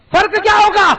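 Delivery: loud, high rhetorical cry; shouted question. Acted. Preacher challenging the congregation, shouting the question. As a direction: fling the question at the crowd.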